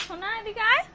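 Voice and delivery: high call across the room to her son. Real, not acted.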